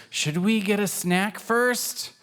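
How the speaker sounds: high, slow questioning kitten voice; wondering kitten. A narrator's reading. Kitten with a rumbling tummy, high and slow. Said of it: "Pancake wonders".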